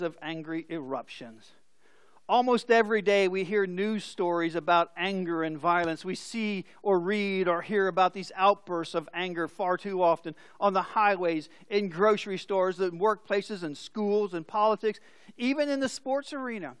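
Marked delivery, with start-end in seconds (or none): none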